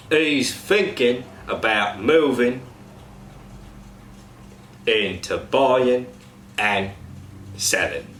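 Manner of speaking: slow Cockney with "thinking", "buying" ending in n; slow example in Cockney; Cockney ing to n. Shown as a demonstration. Coach demos ing to n to cast. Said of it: "Example sentence".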